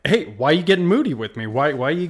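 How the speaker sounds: loud, high, quick mock challenge; confrontational. Friend imagining a retort to the agent, mock confrontational. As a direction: playfully act out snapping back.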